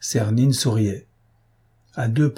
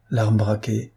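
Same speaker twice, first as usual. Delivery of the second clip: ordinary delivery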